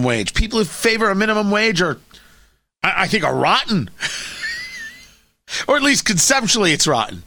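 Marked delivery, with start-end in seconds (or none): 3.9-4.9 short laugh. Real, not acted. Laughing at his own jab.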